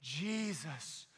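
slow, rising into loud fervent prayer; intense prayer. Retold or imitated. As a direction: pray with growing fervour. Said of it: "And she goes".

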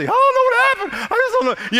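very high, fake-teary whine; hammed-up sobbing girl voice; mock crying teen. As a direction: whimper it like a teen playing for sympathy. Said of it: "and they're crying, like, get sympathy".

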